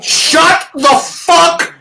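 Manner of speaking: angry rant. Real, not acted. Mocking podcasters, he yells in angry rant. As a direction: blow up at the fake congratulators. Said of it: "And that is your rant".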